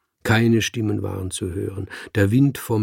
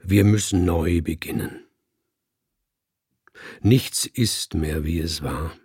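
sonorous speech. Acted. The speaker addresses the cats, sonorous and solemn. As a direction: hold the voice full and sonorous, like an orator. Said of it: "hielt meine Stimme sonor".

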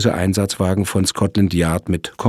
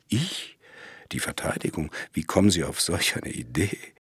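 taken aback, voice drops to near hush; startled, hushed disbelief; 8 dB quieter than usual. Acted. Lawyer stunned by the detective's question, hushed disbelief. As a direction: caught off guard, asking it quietly.

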